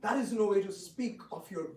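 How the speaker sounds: chiding. Acted. King chiding Rukmi.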